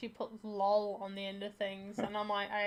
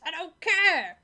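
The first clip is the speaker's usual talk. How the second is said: loud, higher, indignant brush-off; mock petulant outburst; 7 semitones higher, 11 dB louder, slower than usual. Playing Jesus refusing excuses about figs, hammed-up petulant shout. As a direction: shout down the excuse in comic outrage.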